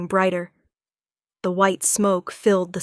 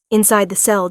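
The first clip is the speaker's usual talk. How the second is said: plain reading voice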